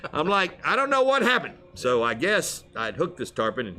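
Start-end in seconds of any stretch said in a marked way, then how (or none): 0.7-1.5 high-pitched retold bewilderment; mock-innocent excuse. Angler excuses himself after the fish mishap, mock innocent. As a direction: plead ignorance in a raised voice.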